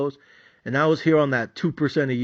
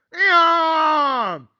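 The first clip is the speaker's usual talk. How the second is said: frustrated groan; 13 semitones higher, 4 dB louder than usual. Retold or imitated. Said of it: "I was mad, frustrated, irritated".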